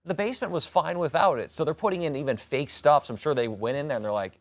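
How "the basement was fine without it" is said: mock protest. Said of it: "I was like".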